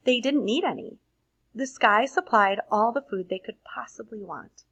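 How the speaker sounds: put-on lively read-aloud for children; expressive storybook reading to a class; story narration. A narrator's reading. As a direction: read it like a bedtime picture book. Reads picture book aloud.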